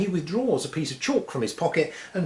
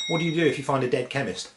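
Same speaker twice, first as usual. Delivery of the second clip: ordinary delivery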